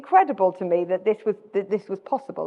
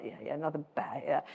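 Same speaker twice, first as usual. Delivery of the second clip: much softer, lower mock indifference; blasé; 6 semitones lower, 14 dB quieter than usual. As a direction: toss it away, acting thoroughly unimpressed. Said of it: "I was quite blasé about them".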